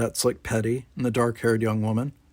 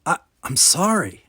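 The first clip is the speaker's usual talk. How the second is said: stammering apology; 6 semitones higher than usual. Acted. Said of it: "stammered Geordi".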